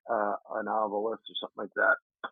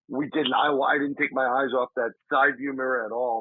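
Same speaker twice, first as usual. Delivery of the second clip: ordinary talk, nothing marked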